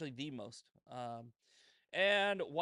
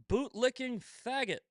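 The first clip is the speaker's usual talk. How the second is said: reads the insult out louder and higher, savouring it; mocking read; 9 semitones higher, 7 dB louder than usual. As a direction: relish the insult like a compliment. Reading hate mail aloud.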